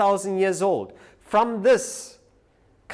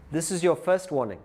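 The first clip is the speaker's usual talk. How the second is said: ordinary talk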